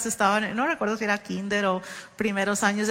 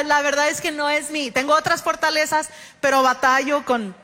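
normal talking voice

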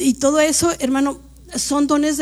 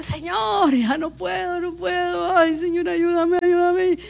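higher, mildly pleading cry to God; pleading prayer; 5 semitones higher than usual. Retold or imitated. Preacher voicing her own desperate prayer, mild pleading. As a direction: beg the Lord for help, lightly acted. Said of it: "no sé".

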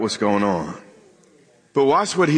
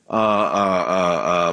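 low, halting string of uhs; stuttering; 6 semitones lower, slower than usual. Real, not acted. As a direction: stumble slowly before finding the word. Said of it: "I'm stuttering a little bit".

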